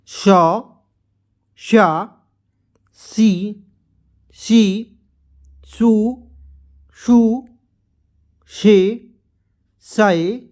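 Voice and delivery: one drawn-out syllable at a time, long pauses between; syllable-chart recitation. Shown as a demonstration. Hindi teacher reciting the barakhadi to learners, syllable-chart recitation. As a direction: recite each letter form deliberately for learners.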